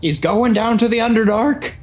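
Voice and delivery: loud, high-pitched huffy yell; huffy shout. A narrator's reading. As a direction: yell it toward the forge, annoyed. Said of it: "in a huffy voice, shouts out to the forge".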